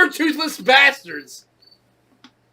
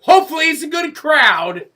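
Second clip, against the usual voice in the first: loud, higher mock exchange; mock dialogue; 6 semitones higher, 8 dB louder than usual. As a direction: act out the crewman, loud and higher.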